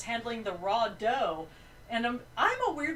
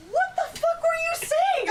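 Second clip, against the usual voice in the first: very high, loud retold outburst; incredulous demand; 8 dB louder than usual. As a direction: yell it like someone bursting in baffled. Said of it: "He just comes out, I was like".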